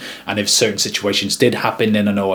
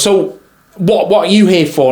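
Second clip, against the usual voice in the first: high, challenging imitation; mock passive-aggressive questioning, pitched up; 8 semitones higher, 4 dB louder than usual. Photographer challenges the videographer's presence, imitated passive-aggressive tone. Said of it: "very passive aggressive in her tone".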